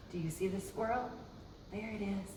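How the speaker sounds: gasp, then soft hushed question; hushed aside. Real, not acted. Host pointing out the squirrel in the picture, hushed. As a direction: lean in and show them quietly.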